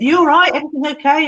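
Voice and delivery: high, lifted caring questions; retold chirpy check-in; friendly check-in. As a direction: call it over brightly to a child. Checking on boy at school.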